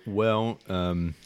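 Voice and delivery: ordinary talk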